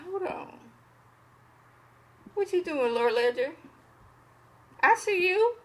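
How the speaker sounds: high, teasing call-out; mock suspicious, catching him out; suspicious side-eye. Viewer calls out Lord Ledger, teasing suspicion. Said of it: "I was like".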